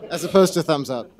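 high, loud words through real laughter; laughing. Real, not acted. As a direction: crack up while getting the line out. Laughing at the thumbs-up joke.

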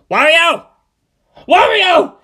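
loud high shouts; yelling. Acted. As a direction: call out desperately for your friend. Waluigi yells for his friend.

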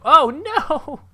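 sudden, loud, high "oh no"; startled cry. Real, not acted. Boat crashes, a loud, high startled cry. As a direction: yelp as the boat breaks.

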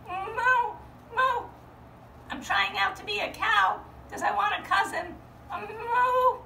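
high, fast lamb trying to be a cow; a lamb hamming up a moo; sheep pretending to moo. Little sheep tries out as a cow, high and fast.

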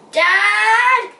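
high, loud, long call for Dad; shout. A narrator's reading. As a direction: shout across a big tent. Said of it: "she shouted across the marquee".

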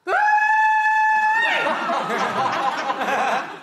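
high shrieking burst of laughter. Real, not acted. Comic laughing at her audience joke, shrieking laugh. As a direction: cackle out loud at your own joke.

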